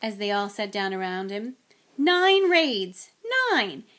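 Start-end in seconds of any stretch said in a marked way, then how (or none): 2.0-3.8 exasperated. Acted. Weary indignant outburst.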